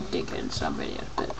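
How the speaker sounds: hushed, voiceless mutter; whispered aside. Real, not acted. Admitting it about a YouTuber, whispered aside. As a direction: drop to a whisper.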